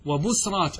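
وبصرات as "wa-bus-raat", no vowel after s; vowelless middle consonant. Shown as a demonstration. Teacher gives three options to students, وبصرات as "wa-bus-raat". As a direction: run the s straight into r in وبصرات. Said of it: "بالسكون".

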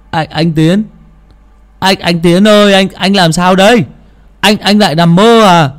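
high, anxious calling of his name; worried stammering call. Acted. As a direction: shake him awake in fear. Boys wake him, worried. (gọi hoảng hốt)

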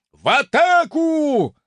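high, loud, slow yell; the Viking happily echoing the order; eager battle cry. Acted. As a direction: chime in eagerly with the charge. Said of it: "охотно поддакнул чёртов викинг".

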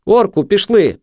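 high, loud plea; pleading, pitched well up. A narrator's reading. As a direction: urge him to come away. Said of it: "просила Марта".

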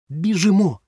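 loud high urgent cry; boy urging his friend to run; exclaiming. A narrator's reading. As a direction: yell it and go. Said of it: "вигукнув Ромка".

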